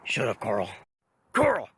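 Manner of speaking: normal talking voice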